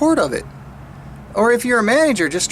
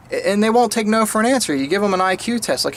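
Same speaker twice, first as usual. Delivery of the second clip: usual speaking voice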